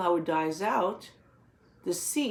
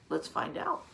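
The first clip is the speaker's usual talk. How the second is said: suspenseful little whisper; 4 dB quieter than usual. Real, not acted. Teacher building suspense for children.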